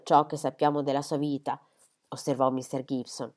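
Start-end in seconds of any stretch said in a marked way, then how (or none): none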